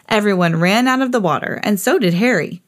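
plain reading voice

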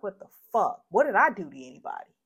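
fed-up, incredulous protest; indignant outburst. Retold or imitated. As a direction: demand an answer in angry disbelief. Venting about being shot at.

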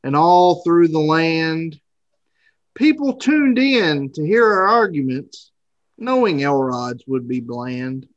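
reading a rhyming Christmas poem; poem recitation. Acted. Rhymed holiday poem opening.

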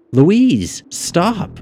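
put-on high, insistent girl's protest. A narrator's reading. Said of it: "the girl insisted".

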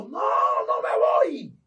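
slow, loud anguished demon cry; screaming demon refusing to leave. Retold or imitated. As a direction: howl it out as a stubborn demon. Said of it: "el demonio gritó".

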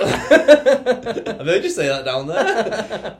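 slow, high, laughing through words; real helpless laughter. Real, not acted. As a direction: struggle to talk for laughing. Laughing recollection.

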